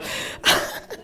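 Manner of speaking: short unvoiced embarrassed chuckle; soft breathy laugh. Real, not acted. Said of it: "I'm not supposed to get emotional".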